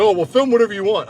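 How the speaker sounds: high, loud mocking voice of the gym guy; scoffing. The gym guy brushing him off, mock high scoffing voice.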